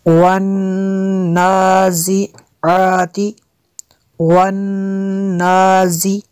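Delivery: slow chanted "वन्नाज़िआति", nasal hold on the n; ghunnah recitation. Shown as a demonstration. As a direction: hum long on the doubled n in "वन्नाज़िआति". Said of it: "नून में शद्दद है इसलिए गुन्ना किया".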